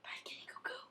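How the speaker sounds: whisper. Real, not acted. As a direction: whisper it to the cat. Whispering to the cat.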